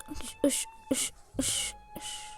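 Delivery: voiceless, whispered little bursts; whispered hushing. Real, not acted. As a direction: whisper each one like a hushing sound. Hushed effort noises while playing.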